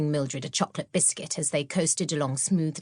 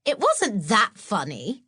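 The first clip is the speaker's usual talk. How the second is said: snorting; 4 semitones higher, 3 dB louder than usual. Acted. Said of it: "snorted Mildred".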